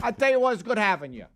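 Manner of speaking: put-on high Brooklyn accent. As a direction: lay on the Brooklyn. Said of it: "it's a poor accent".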